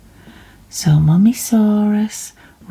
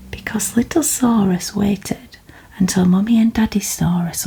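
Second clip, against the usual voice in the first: whispered story reading. Real, not acted. Reader whispering a bedtime story to listeners.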